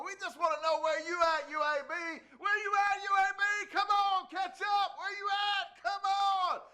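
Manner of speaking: high, loud taunting chant; calling out. Acted. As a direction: yell across town at a rival school. Calling out UAB on air.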